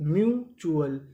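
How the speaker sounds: Mutual split as myu, then chu-al; syllable by syllable. Shown as a demonstration. Teacher joining two codes for learners, mutual in separate pieces. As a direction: stretch mu and pause before चुअल. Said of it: "तो पूरी meaning".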